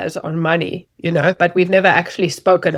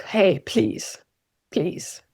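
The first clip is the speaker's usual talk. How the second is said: soft, worn-down plea; hushed, half-hearted begging; 8 dB quieter, much slower than usual. Acted. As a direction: plead quietly, voice dropping away on the last please. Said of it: "like, begging".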